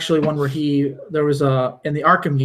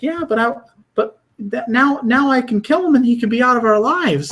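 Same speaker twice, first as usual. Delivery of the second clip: Harley Quinn; 9 semitones higher than usual. Retold or imitated. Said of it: "she's like".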